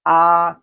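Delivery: loud AH as for the doctor; AH held steady in the upper range; high level tone. Shown as a demonstration. Doctor asks you to open your mouth, high level AH. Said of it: "the doctor asks you".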